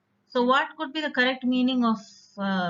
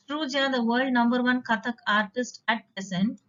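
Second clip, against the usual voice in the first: ordinary talk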